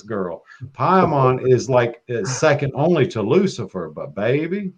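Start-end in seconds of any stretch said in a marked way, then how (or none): none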